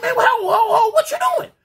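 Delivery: high-pitched reenacted holler; frantic, high protesting shout; alarmed shout. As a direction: yell to stop someone mid-act. Acted shout at the kicker.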